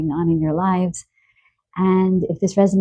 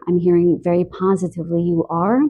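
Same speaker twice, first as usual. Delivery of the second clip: ordinary talk, nothing marked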